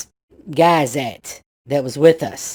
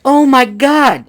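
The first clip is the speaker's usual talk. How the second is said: high, loud, slow shocked "oh my God"; frightened gasp; 10 semitones higher, 8 dB louder, slower than usual. Retold or imitated. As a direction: cry it out like you've just seen something awful. Girl sees something frightening.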